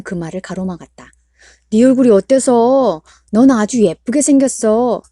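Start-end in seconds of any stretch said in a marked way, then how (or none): 1.7-5.0 loud, emphatic father cutting her off; insistent reassurance. Acted. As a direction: brush her worry aside forcefully. Father hastily contradicts daughter.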